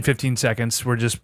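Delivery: ordinary delivery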